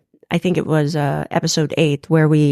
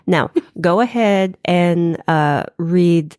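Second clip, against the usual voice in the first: usual speaking voice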